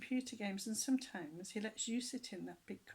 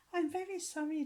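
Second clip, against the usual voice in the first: goody-goody voice; 8 semitones higher, 6 dB louder than usual. A narrator's reading. Peter apologizing sweetly.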